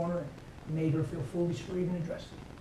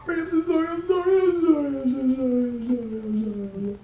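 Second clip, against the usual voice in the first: much higher, louder pleading sorry; retold crying apology; 8 semitones higher, 6 dB louder than usual. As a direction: replay the wife's tearful apology, pitch way up. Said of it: "She come running in the yard crying".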